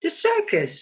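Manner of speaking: The circus. jumps high, then falls firmly; matter-of-fact confirming. Acted. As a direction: say The circus. brightly high as the plain answer. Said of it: "replies the landlord".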